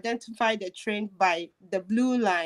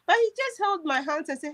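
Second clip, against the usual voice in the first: ordinary talk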